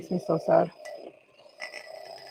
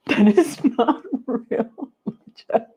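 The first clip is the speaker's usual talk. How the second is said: laughing; 3 semitones higher, slower than usual. Real, not acted. Laughing at friend's Starbucks matcha.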